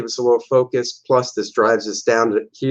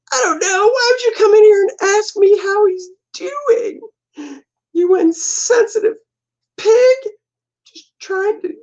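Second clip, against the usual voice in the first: very high, tearful, lashing out; very high, hammed-up weepy wife; crying wife; 3 dB louder than usual. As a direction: sob at him, hurt and accusing.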